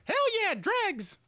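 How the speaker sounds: rowdy crowd member. Retold or imitated. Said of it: "Someone's like".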